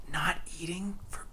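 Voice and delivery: breathy secretive whisper. Pretend. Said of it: "Why are you whispering?".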